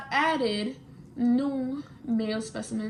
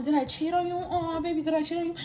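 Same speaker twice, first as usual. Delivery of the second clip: mocking fake-hurt, a bit higher; sarcastic whine; 4 semitones higher, faster than usual. Taunting her boyfriend with mock tears about cheating. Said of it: "I was being kind of an asshole".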